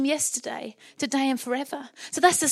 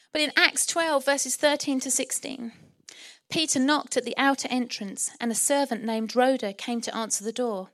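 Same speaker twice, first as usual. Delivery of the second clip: normal talking voice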